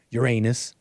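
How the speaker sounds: Uranus said "your-AY-nus"; the other pronunciation. Shown as a demonstration. Host recalls the classroom giggle word, Uranus as your-AY-nus. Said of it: "heard the other one".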